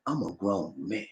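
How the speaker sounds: low, slow, very soft declaration; hushed, deliberate, grave assertion; heartfelt, emphatic. Shown as a demonstration. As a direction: state it quietly with weight and feeling. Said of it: "You gotta say it with heart".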